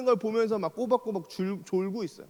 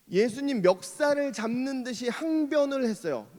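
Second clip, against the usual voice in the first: ordinary delivery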